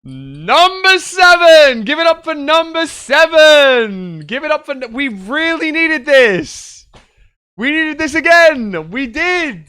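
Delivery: huge high hype shout; booming pumped announcer. Acted. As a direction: roar it like a stadium announcer. Crowd hype announcer clip.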